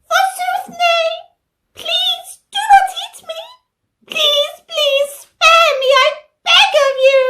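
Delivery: high squeaky pleading vegetable voice; flowery old fashioned speech. Acted. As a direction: beg for mercy in a tiny high voice. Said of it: "Here is Kate from Taming of the Shrew".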